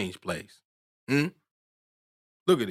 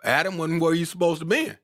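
higher, excited preacher's delivery; worked-up preaching; 8 semitones higher than usual. Real, not acted. Preacher pressing congregation about meeting God, worked up. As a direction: drive the point home, worked up. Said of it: "Y'all can see I'm getting worked up".

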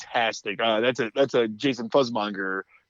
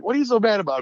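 a hint of the wife, higher pitch; wife's question; 10 semitones higher, 4 dB louder, faster than usual. Wife asking husband why he's angry, lightly higher voice. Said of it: "my wife's like".

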